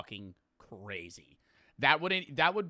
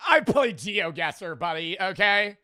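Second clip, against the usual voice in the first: loud, slow, higher retort; 6 semitones higher, 10 dB louder, slower than usual. Real, not acted. Streamer snapping back at doubting chat, loud and slow.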